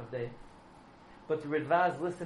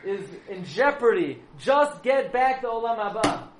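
high, loud, frantic pleading from the dead husband; desperate yelling; 7 semitones higher, 7 dB louder than usual. Acted. Husband in wife's dream, yelling at her to get his share back. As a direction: shout it like a tormented soul begging. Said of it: "He's yelling".

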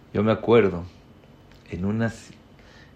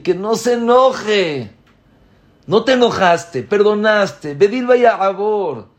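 much higher and louder, building to a peak; impassioned preaching; 8 semitones higher, 8 dB louder than usual. Acted. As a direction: pour it out at full preaching force. Predica el perdón divino. (predicación apasionada, cada vez más aguda y fuerte)

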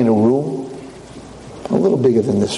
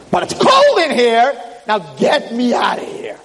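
high, drawn-out indignant yell; desperate shouting; 9 semitones higher, slower than usual. Acted. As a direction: shout to be let out. Freezing in fridge, demands release.

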